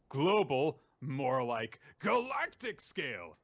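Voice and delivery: scoff, then draw out the bigger claim; Ryusu. Retold or imitated. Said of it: "But Ryusu says".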